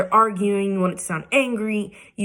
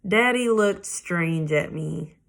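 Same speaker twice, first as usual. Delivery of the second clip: ordinary delivery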